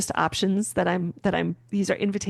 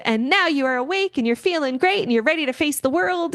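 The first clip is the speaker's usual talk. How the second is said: bright, higher, bouncy wake-up call; super animated; 8 semitones higher than usual. Shown as a demonstration. Mocking a peppy wake-up she won't use, super animated. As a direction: sell the wake-up like a morning show host. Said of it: "I'm not gonna be the super animated".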